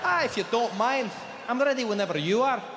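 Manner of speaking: lilting broad Scots; a polite request in thick Scottish; Scottish accent. Acted. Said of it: "I've got the Scottish Indian man".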